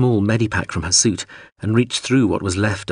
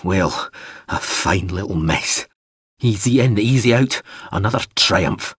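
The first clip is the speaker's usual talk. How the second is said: shaking voice. A narrator's reading. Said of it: "his voice shaking".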